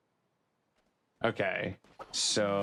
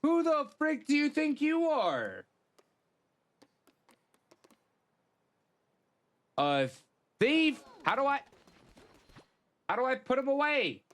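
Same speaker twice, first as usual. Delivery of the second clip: high squeaky indignant voice; mock-outraged falsetto; squeaky outrage. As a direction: whine it up high in disbelief. Outraged at game character.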